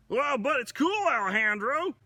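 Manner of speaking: high, eager younger bear voice; a cheerful little-brother bear calling out, pitched high; Buzz's voice. Acted. Buzz greets his older brother Alejandro, high and eager.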